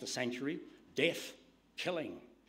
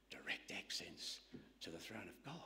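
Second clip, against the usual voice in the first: hushed whisper; 5 semitones lower, 12 dB quieter than usual. Real, not acted. Preacher repeats the line hushed.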